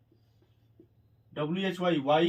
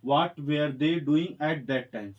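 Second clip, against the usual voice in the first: drawn out word by word; slow dictation; 2 semitones lower, much slower than usual. Shown as a demonstration. Teacher dictating to students, very slow. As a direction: give each word its own beat. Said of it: "इसका बनेगा".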